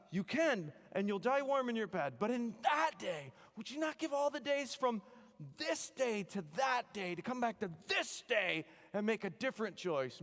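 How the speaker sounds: rally the troops like William Wallace; rousing battle speech. Retold or imitated.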